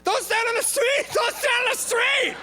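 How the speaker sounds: very high-pitched, loud mimicked yelling; imitated group yell. Bystanders yell a warning to man in the road, high imitated shout.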